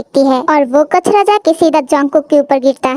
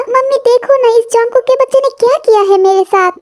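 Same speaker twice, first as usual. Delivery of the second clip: whiny complaint; 6 semitones higher than usual. Acted. Daughter complains to mother.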